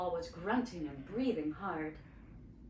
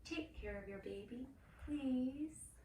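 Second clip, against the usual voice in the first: softer pleading voice; soft begging; 4 semitones higher, 6 dB quieter than usual. A narrator's reading. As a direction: plead gently with the sheep. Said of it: "Miriam begged".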